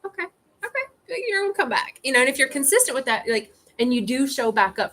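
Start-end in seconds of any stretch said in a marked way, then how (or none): 0.0-2.0 put-on high, small kid's voice, soothed; reassured little kid. Calmed inner child answering the adult, high kid voice. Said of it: "the kid will be like".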